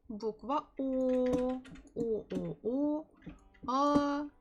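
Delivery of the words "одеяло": Одеяло with я stressed. Shown as a demonstration. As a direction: hit the я in Одеяло. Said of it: "the stress falls on я".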